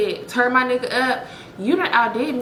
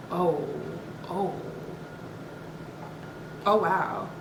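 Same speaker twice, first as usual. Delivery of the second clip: normal talking voice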